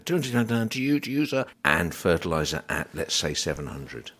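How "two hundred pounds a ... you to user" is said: high, loud auctioneer call. Pretend. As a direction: sell it like an auctioneer. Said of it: "I sound like an auctioneer now".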